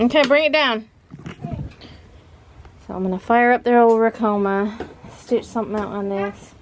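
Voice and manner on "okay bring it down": calling out high and loud; yelling across the room. Real, not acted. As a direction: shout up to her. Calling to daughter upstairs.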